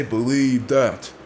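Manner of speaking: slow, drawn-out mocking "Believe that."; lightly hammed-up Roman impression; mock Roman Reigns catchphrase. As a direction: drag it out flat and bored. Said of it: "But he would say".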